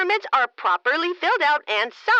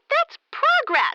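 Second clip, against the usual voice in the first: smug; 11 semitones higher, slower than usual. Acted. Mayor boasting about business.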